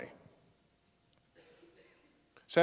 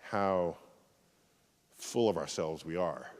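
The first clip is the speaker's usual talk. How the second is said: low, much softer and reverent; solemn reflection; 9 semitones lower, 6 dB quieter than usual. Real, not acted. Closing the talk on Earth as a speck, low and reverent. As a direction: let it settle like a quiet awe. Closes with pale blue dot reflection.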